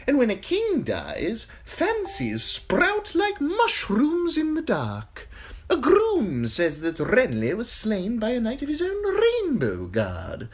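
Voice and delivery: high, sly eunuch voice, unhurried; high giggly spymaster relishing the gruesome news; Varys the eunuch. Acted. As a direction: purr it high and insinuating, a courtier savouring gossip. Varys voice held.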